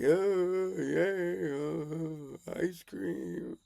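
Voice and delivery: drawn-out, soft doggy longing. Acted. The dog spots the ice cream, long yearning doggy voice. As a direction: stretch the words like a begging dog. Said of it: "the dog is like".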